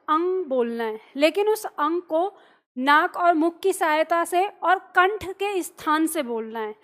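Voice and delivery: normal talking voice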